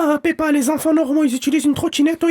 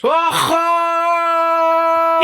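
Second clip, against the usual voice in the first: shouted Aha. Shown as a demonstration. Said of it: "Il faut crier Aha de toutes ses forces".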